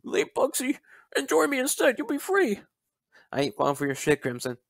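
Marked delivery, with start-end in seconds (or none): 0.0-2.6 high, put-on voice mimicking a tempter; mocking quote. Mocking Crimson's pitch to the listener in a high put-on voice. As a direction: sneer it like a cheesy recruiter, much higher.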